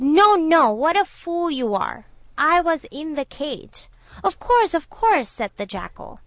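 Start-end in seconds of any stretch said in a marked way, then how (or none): 0.0-3.9 loud, high-pitched scolding; exasperated correction. A narrator's reading. Correcting a muddled retelling, loud exasperation. 4.2-5.3 high, loud, flustered agreement; hasty mock-frightened appeasing; feigned fright. A narrator's reading. Jackal pretending to tremble before the tiger, flustered fright. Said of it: "pretending to tremble with fright".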